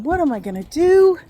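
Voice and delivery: high, loud mock fretting; retold fretting. Passenger wonders how to reach mom, high mock fretting. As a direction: exclaim it like a retold worry. Retold worried thought on the bus.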